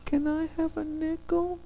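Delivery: put-on high, sweet little-girl ask. Pastor imitating a little girl asking for a nickel, high and sweet. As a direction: squeak it like a tiny girl begging. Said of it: "she says".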